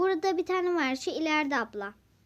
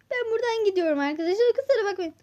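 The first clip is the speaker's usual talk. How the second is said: panicked rush; 7 semitones higher, 5 dB louder, faster than usual. Acted. Fleeing the spider.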